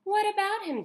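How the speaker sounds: high, loud put-on mother; mother's voice. A narrator's reading. Mother answering her daughter at dinner, high put-on voice. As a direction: say it sweetly as the mother.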